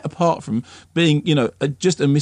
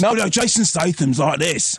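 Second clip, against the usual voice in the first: Jason Statham; 3 semitones higher than usual. Retold or imitated. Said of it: "your Jason Statham voice".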